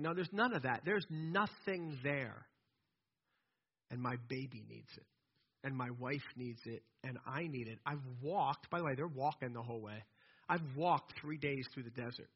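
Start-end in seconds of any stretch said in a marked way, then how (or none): 3.9-12.3 soft, low, weary-father complaint; understated grievance. Acted. Thirsty father complains to Moses, quiet grievance. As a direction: lay out each need quietly and low.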